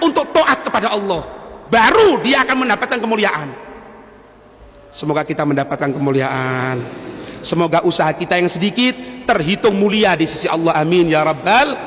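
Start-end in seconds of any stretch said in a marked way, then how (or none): 4.9-11.7 low, subdued prayer voice; closing prayer. Real, not acted. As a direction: lower the voice into a closing supplication. (doa penutup dengan suara rendah)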